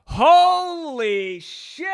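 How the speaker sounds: long drawn-out, high exclamation; mock amazement. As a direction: stretch it out high in astonishment.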